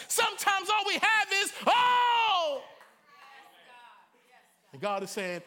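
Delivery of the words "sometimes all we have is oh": rising, loud preacher cry; preaching at full force. Acted. Preacher crying out to his congregation, loud. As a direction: build to a loud desperate cry.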